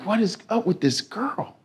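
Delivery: puzzled disbelief. Retold or imitated. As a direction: mutter it as a bewildered passenger. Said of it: "he's like".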